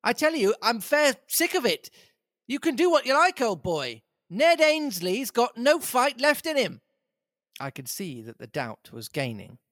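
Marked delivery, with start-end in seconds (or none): 0.0-6.8 high, rough tramp voice; lightly put-on beggar's whine; tramp Hannay. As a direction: play the ragged Scots tramp, fed up. Tramp voice in story.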